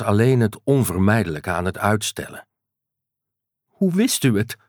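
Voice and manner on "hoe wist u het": high, tearful question. Acted. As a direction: ask through tears. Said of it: "Patricia zat te huilen".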